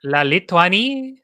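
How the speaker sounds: put-on La Lituanie in a playful French accent; mock French announcer. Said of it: "I'm saying it with a weird accent".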